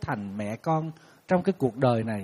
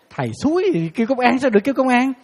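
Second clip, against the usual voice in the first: exasperated exclaim; 8 semitones higher, 5 dB louder than usual. Real, not acted.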